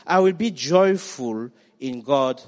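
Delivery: loud, slow declaration of faith; full-force preaching. Acted. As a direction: proclaim it to the congregation.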